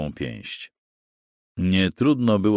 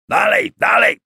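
very high, loud frantic yell; frenzied shouted urging; 7 dB louder than usual. A narrator's reading. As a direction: scream the men onward. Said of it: "wrzeszczał Diber".

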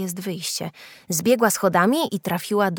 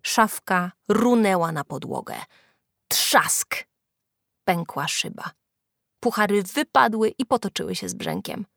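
ordinary delivery

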